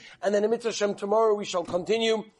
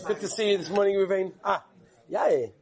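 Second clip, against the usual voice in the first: ordinary delivery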